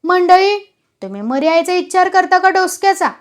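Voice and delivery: acted exasperation; exasperated. A narrator's reading. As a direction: cut through the squabble in annoyance. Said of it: "बिऱ्या Dhangar वैतागून म्हणाला".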